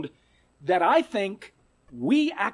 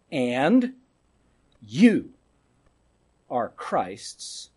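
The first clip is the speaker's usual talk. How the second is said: ordinary talk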